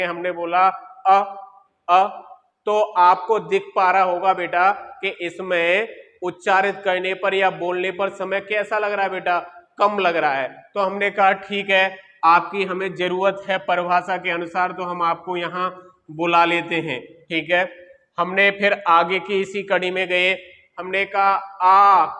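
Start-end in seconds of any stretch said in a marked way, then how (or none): 0.0-2.1 short clipped अ said for the class; short vowel demo. Shown as a demonstration. Teacher to students demonstrating short vowel अ, short and crisp. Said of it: "आप सभी मेरे साथ बोलेंगे". 20.8-22.0 आ drawn out long and loud; long vowel demo. Shown as a demonstration. Teacher to class showing long vowel आ, drawn out loud. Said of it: "समय ज्यादा लग रहा है".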